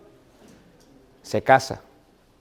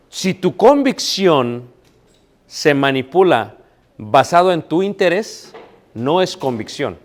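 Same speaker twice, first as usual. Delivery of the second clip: slow emphatic point; 2 semitones higher, slower than usual. Acted.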